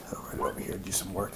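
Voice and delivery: near-whispered aside while writing; quiet, whispery thinking aloud; muttering to self. Real, not acted. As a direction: say it under your breath, barely voiced.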